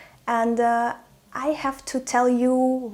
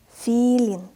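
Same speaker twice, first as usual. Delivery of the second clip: drawn-out "Филин"; slow reading; 4 dB louder than usual. Shown as a demonstration. Teacher models the word for beginners, "Филин" read slowly. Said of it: "I will read this word slowly".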